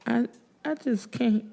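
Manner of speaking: near tears. A narrator's reading. Widow near tears, cannot stay.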